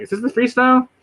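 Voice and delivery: high-pitched mock question. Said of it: "You're like".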